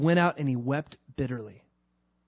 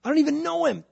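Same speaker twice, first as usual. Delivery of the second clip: high, loud, vehement denial; 11 semitones higher, 5 dB louder, faster than usual. Acted. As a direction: insist hard, pitched up high. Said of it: "That's what he was saying".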